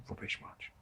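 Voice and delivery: whispered, dismissive aside; whispered verdict. Real, not acted. Reviewer dismissing a rubbish match, whispered scorn. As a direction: breathe it out with contempt.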